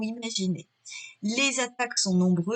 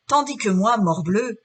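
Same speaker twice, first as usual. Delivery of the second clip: louder, indignant stage outburst; theatrical indignation; 7 dB louder than usual. Acted. Figaro setting himself against the Count, loud indignation. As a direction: punch out the oath with force. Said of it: "je le mets en voix".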